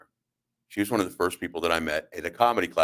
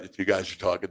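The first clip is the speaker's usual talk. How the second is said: ordinary delivery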